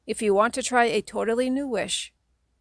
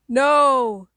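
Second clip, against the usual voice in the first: higher, much louder terrified cry; shriek; 6 semitones higher, 8 dB louder than usual. A narrator's reading. The kid shrieks in horror as the curse hits, loud and terrified. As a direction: shriek it as you collapse. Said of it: "you shriek again".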